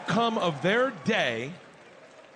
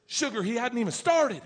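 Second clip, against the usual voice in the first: sweetly cutting; 6 semitones higher than usual. Retold or imitated. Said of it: "takes a breath and goes".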